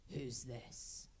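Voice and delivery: low imitation of a man on the phone; deep voice. Woman imitating the man who answered her call, deep voice. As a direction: drop low to voice his question. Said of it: "this deep voice answer".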